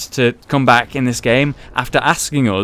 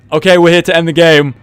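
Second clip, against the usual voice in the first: mock insistent demand; 5 semitones higher, 6 dB louder, faster than usual. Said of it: "It's like".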